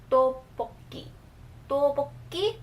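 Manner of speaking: "tteokbokki" begun with a tight D sound; careful "tteokbokki", tense D up front. Shown as a demonstration. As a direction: model "tteokbokki" with a D-like start. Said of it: "has the D sound".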